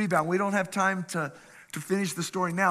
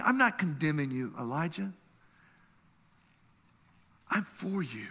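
softer, tender reassurance; reassuring God; 4 dB quieter than usual. Retold or imitated. God reassuring Elijah, soft and tender. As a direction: gently comfort him. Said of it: "You know what he's saying?".

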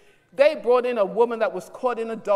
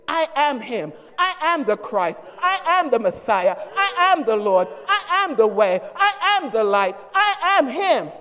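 louder, climbing I am declarations; emphatic declaration; 3 semitones higher, 3 dB louder than usual. Pretend. Jesus telling the naysayers who he is, emphatic. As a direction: proclaim each I am with force.

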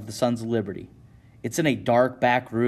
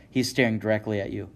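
ordinary delivery, nothing marked

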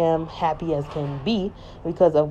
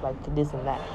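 ordinary talk

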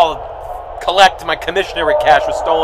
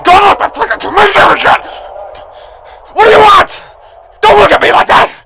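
loud, higher shouting at a cellmate; angry yelling; 13 semitones higher, 7 dB louder than usual. Acted. Inmate yells at someone in the prison, angry. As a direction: bark at someone nearby.

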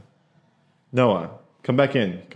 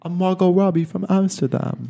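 higher-pitched attempt at an accent; put-on Margot Robbie accent; 7 semitones higher than usual. Host showing guest how to say it in the actress's accent, higher. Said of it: "Say, doing the accent".

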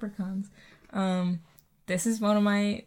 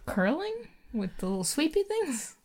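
high confused squeak; puzzled, pitch shooting up; 7 semitones higher than usual. Retold or imitated. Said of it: "I was like".

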